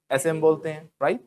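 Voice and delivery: ordinary talk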